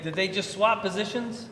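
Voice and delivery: normal talking voice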